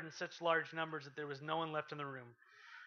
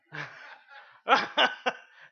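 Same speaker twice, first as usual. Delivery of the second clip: loud, high burst of laughter; big laugh; 10 semitones higher, 14 dB louder than usual. Real, not acted. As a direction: laugh at your own joke. Preacher laughs at own joke.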